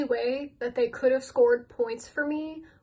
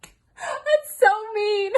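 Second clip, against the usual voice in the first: much higher, laughing through the words; real helpless laughter; 10 semitones higher, 6 dB louder, much slower than usual. Real, not acted. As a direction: giggle it out at your own meanness. Laughing at own harsh joke.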